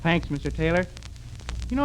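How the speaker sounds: ordinary delivery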